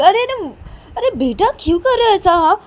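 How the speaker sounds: high, loud pleading woman; pleading mother. Acted. Shruti pleads with the boy, high and loud. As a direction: coax the child to stop hitting. Shruti asks child to stop.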